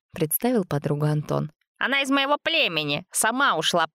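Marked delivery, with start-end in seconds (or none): 1.8-3.9 proud boasting. A narrator's reading. Антон boasting about his girlfriend.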